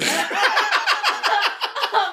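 loud high burst of laughter; big laugh. Real, not acted. Co-host laughs out loud at her own slip. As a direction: crack up at what she just admitted.

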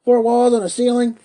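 high, very loud scornful question; crying out. A narrator's reading. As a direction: throw it out as an incredulous shout. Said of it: "cried Tackleton".

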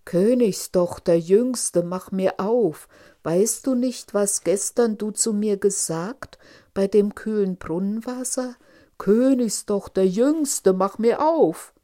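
loud, insistent frog call at the door. A narrator's reading. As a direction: demand to be let in, at full volume. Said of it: "klopfte es zum zweiten Mal und rief". (laut und fordernd rufender Frosch)

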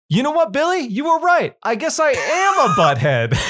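high, earnest mock confession from the dad; hammed-up dad. Dad turns to Billy and admits he is right, high mock sincerity. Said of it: "his dad turns to him and says".

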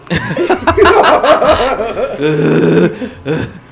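long, loud, high laughing fit; loud high cackle that keeps going; uproarious real laugh. Real, not acted. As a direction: burst out laughing hard. Laughing at the video.

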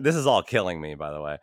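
normal talking voice

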